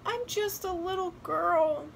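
put-on high little-girl voice, understated sobs; mildly tearful. A narrator's reading. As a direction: plead as a tearful child. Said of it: "she said between sobs".

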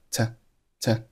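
to shrunk to a bare t; weak form of to. Shown as a demonstration. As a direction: clip to down to just t. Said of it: "后面的to是弱读".

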